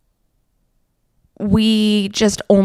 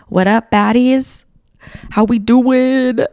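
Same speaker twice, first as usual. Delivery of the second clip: slow put-on cool voice; playful hype greeting; 3 semitones higher, 3 dB louder, slower than usual. Host greeting Scandinavian fans, slow put-on cool voice.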